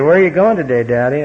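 little daughter. Retold or imitated. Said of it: "She said".

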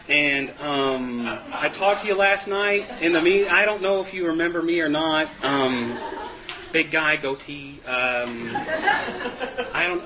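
awkward, halting message full of ums; hesitant mock voicemail, stretching out the fillers; rambling voicemail message. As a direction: re-enact a nervous, drawn-out phone request. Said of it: "I left the ten-minute long conver-, you know, message on his voicemail".

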